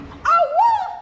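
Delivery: very high, loud squeal; panicked piglet. Acted. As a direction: shriek the word in alarm. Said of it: "Pig cried".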